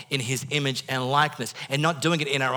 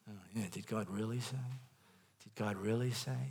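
much softer, almost under the breath; inner doubting voice; 10 dB quieter than usual. Acted. The devil's voice as your own doubt, very soft. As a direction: murmur it like a thought in your own head. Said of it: "hear the devil's voice as your own voice".

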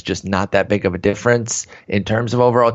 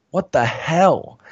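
exasperated; 6 semitones higher, much slower than usual. Real, not acted. Exasperated reaction to homer rate.

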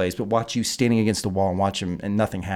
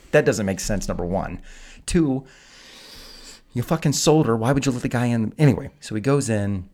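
ordinary delivery

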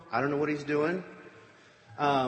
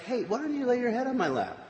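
coaxing Delilah; 9 semitones higher than usual. Retold or imitated. Delilah luring Samson to sleep.

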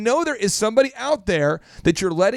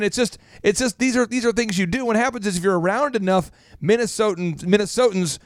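ordinary talk

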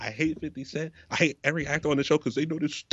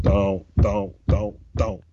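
rising mock plea, repeated don'ts; louder pleading; 3 semitones lower, 4 dB louder, much slower than usual. Pleading louder at the TV character not to do it. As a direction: beg the character to stop.

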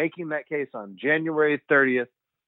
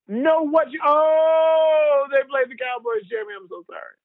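higher mock gasp of realization, then sorry; imitating mom's dismay; 13 semitones higher, 5 dB louder than usual. Son imitates his mother realizing the Cowboys lost, high dismayed gasp. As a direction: play his mom catching on with a high, loud oh.

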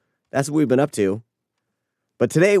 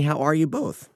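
voice cracking; 3 semitones higher, 3 dB quieter than usual. Real, not acted. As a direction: voice cracks on both. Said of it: "My voice just cracked".

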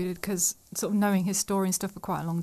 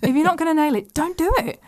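emphatic; 8 semitones higher, 5 dB louder than usual. Real, not acted. Emphatic advice on accents.

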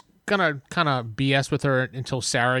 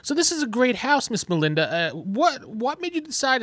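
higher-pitched, casually chatty Count; in-character small talk; 11 semitones higher than usual. Acted. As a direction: make pointed small talk in a raised voice. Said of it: "for the earpiece and be like".

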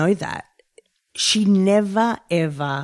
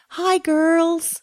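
a light imitation of a warm hello, higher; imitating warm greeting; 11 semitones higher than usual. Host retelling Jackie's greeting to the co-hosts, lightly imitated and warm. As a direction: greet them kindly, like Jackie did. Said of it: "so warm and lovely".

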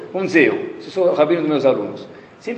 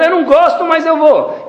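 higher, louder grudging complaint; reluctant father; 13 semitones higher, 5 dB louder than usual. Retold or imitated. Father admits to his son he goes reluctantly. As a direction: whine it like a father dragged to the shiur. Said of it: "Então o que ele tá falando".

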